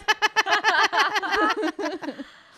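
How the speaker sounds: loud high burst of laughter; big laugh. Real, not acted.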